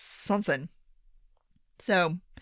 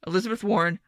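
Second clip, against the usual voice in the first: usual speaking voice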